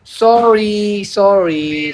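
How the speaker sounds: slow, stretched teasing sorry; drawn-out mock apology. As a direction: refuse with each sorry held long. Refusing payment in prank call. (चिढ़ाते हुए खींचकर sorry)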